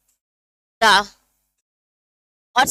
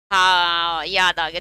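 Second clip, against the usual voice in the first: mock student remembering, much lower, drawn-out हाँ; feeling-laden recollection; 8 semitones lower, much slower than usual. Teacher imitating a student recalling a school story, full of feeling. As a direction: drop low and imitate a heartfelt "now I remember". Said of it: "देखो कितनी feelings के साथ".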